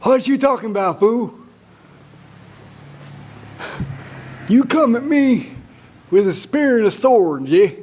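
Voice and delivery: high, loud cocky little guy; David's voice. Acted. Little David answering Goliath's taunt, high loud defiance. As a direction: shout back with streetwise bravado. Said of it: "And David says".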